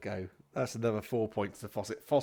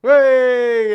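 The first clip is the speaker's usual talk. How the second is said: loud, high crowd "hey"; rousing cheer; 14 semitones higher, 18 dB louder than usual. Acted. Comedian applauds the guest's joke, cheering. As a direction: cheer the punchline at full voice.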